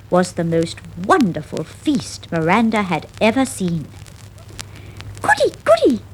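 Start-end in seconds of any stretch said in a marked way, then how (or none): 5.2-6.0 very high squeaking mouse; squeaky. Acted. Miranda delighted at the cheese, squeaking high. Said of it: "she squeaked".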